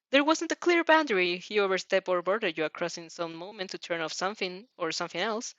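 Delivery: ordinary delivery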